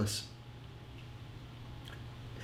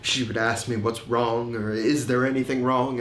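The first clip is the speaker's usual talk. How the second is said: normal talking voice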